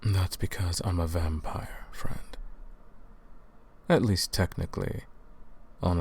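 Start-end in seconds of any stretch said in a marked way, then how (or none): 1.9-2.4 a sly hushed tag at the end; whispered aside. Acted. Vampire hunter confiding his secret to the captive, whispered. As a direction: drop to a whisper on the last word.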